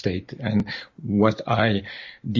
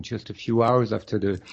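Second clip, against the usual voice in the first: ordinary delivery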